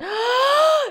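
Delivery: loud high gasp; gasp, then recover; sexy gasp. Retold or imitated. Remembering to be sexy, loud gasp. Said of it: "entonces fue así de".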